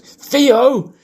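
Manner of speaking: high shouted name, careful TH in "Theo"; shouting in a park. Shown as a demonstration. Calling a dog while minding the th, shouting. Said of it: "I've gotta think about that th- noise".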